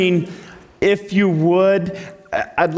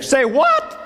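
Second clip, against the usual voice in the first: high surprised yelp; can't believe the order; mock startled squeak; incredulous Moses; 9 semitones higher than usual.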